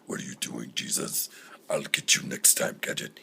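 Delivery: very low, far-off villain rasp, whispered in places; cartoon villain voice. Demon speaking to Jesus as a cartoon villain, very low growl. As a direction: growl it like the hidden villain in Inspector Gadget. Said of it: "the bad guy from the Inspector Gadget cartoons".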